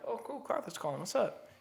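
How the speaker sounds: the corrected take, a mild friendly welcome; cheerful greeting. Retold or imitated. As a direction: sound glad he's calling. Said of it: "No, I didn't actually say it".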